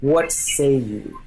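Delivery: measured royal decree with weighty pauses; intent, kingly. Acted. King addresses his generals from the throne, lightly kingly and deliberate. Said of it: "he speaks with intent".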